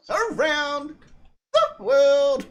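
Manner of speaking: high, loud, drawn-out showman's call; hammed-up title announcement. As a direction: announce it grandly, then break into laughter. Hamming up a segment title.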